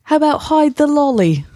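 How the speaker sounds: a high, hammed-up British accent; mock British accent. Shown as a demonstration. Host trying a British accent, cheeky and high. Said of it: "That was more Birmingham".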